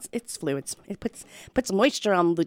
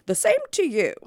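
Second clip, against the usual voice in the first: poor man voice; 9 semitones higher than usual. Acted. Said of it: "said the man".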